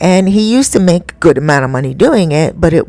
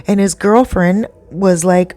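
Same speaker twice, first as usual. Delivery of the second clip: usual speaking voice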